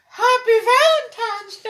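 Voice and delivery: very high, loud, slow put-on voice; hammed-up greeting. Friend mocks the Valentine's greeting to an old man, hammed-up falsetto. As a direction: squeal it like a silly caricature.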